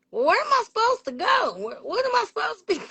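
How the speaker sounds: high-pitched, bewildered pleading; bewildered questioning. Retold or imitated. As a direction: act out being at a loss where to go. Acts out pleading with God.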